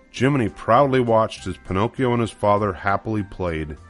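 plain reading voice

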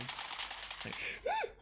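sudden high gasp; sharp gasp. Real, not acted. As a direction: suck in air sharply. Gasp during gameplay.

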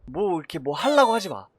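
usual speaking voice

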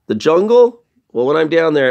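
plain reading voice

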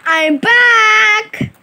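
loud, cheerful arrival call. Acted. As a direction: call out coming home. Character shouts arriving home.

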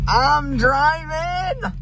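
high, slow villain voice; a high creepy character voice; put-on voice. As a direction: drawl it out high and smug. Golden Freddy voice, put on.

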